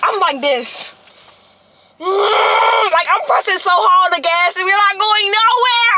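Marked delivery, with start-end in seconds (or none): 2.0-2.9 high strained cry of frustration; frustrated groan. Retold or imitated. Reliving the stalled drive with a strained groan. 2.9-5.9 mock crying. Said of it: "I'm, I'm crying".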